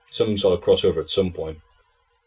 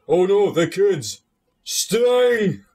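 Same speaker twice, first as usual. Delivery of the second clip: slow, much higher, hammed-up alarm; Bulldog impression; 9 semitones higher, much slower than usual. Mocking Bulldog's boat promo with Sting, hammed-up high alarm. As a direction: overact the panic of a bad promo. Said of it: "All of the lines he delivered".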